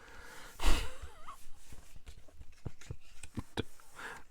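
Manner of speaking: long squeaky rising giggle; high giggle. Real, not acted.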